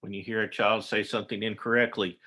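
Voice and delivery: ordinary talk, nothing marked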